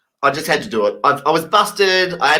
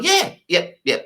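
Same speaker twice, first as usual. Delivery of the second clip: high eager yeps; bluffing agreement; 10 semitones higher, slower than usual. Retold or imitated. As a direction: fake it with bright high yeps. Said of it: "I'm just doing".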